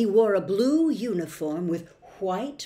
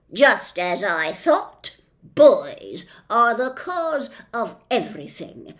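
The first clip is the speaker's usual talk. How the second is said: loud, slowish officious policeman; pompous policeman; 6 dB louder than usual. Acted. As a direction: lay down the law with bluster. Policeman concludes.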